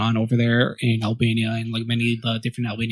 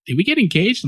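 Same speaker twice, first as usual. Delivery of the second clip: surprised question; 9 semitones higher than usual. Retold or imitated. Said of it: "she's like".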